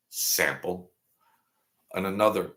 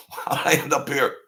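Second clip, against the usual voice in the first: laughing; 6 semitones higher than usual. Real, not acted. Laughing at his own question.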